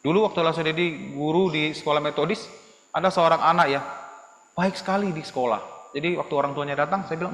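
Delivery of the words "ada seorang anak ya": usual speaking voice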